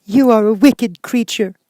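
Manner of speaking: put-on high, loud, slow child's voice; a little girl crying out in anger; outraged child, high and loud. A narrator's reading. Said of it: "cried Dorothy".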